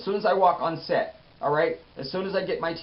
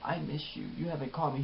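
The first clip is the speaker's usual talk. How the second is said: a hint of choked-up hush, much softer; imitating an overwhelmed woman, hushed; mock verklempt; 11 dB quieter than usual. Melissa tearfully telling Jeffrey she misses him, softer. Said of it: "Melissa is verklempt".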